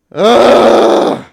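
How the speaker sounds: loud disgusted ugh; frustrated groan. Real, not acted. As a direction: groan in exasperation. Exasperated groan.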